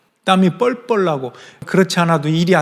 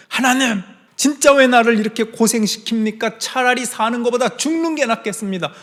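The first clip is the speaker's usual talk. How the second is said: higher-pitched whining complaint to God; exasperated protest; 8 semitones higher than usual. Retold or imitated. Jonah arguing with God about the heat, high exasperated protest. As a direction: plead and protest as if fed up with it all.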